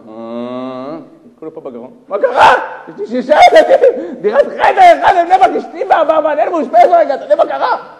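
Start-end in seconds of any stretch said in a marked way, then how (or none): 2.1-7.9 very high, shouted outburst; hammed-up furious yelling of every trouble; nerves exploding. Crying father unloading his hardships on a stranger, exploding. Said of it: "כל העצבים שלו עלו לו ככה".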